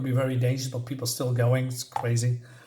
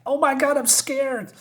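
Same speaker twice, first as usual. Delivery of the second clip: very high mock panic; squeal-pitched acted fright; losing it; 12 semitones higher than usual. Frightened woman by the hole, losing it in acted panic. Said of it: "she is losing it".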